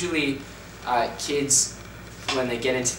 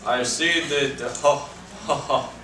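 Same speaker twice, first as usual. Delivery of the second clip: mock German accent; 5 semitones higher, much slower than usual. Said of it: "German, go".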